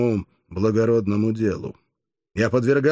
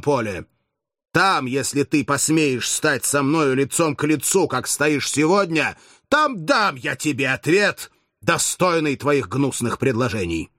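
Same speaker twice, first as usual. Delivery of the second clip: angry; 6 semitones higher than usual. A narrator's reading. Spartacus denounces the consul.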